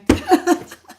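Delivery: a sudden high cackle; high burst of laughter. Real, not acted. Cracking up at her own joke to viewers, high laugh. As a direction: let out a bright laugh at yourself.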